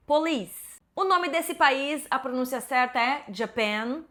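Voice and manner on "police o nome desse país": usual speaking voice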